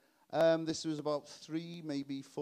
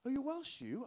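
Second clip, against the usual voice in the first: much higher patronising question; mock Londoner; 9 semitones higher than usual. Londoner patronisingly quizzing a Welshman, high and sing-song. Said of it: "a bit of a novelty".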